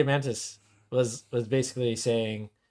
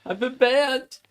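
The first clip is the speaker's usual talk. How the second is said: mock crying wail; 10 semitones higher, slower than usual. Banned visitor wailing to the listener, mock crying. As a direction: fake sob, pitched up high. Mock lament, cry heard.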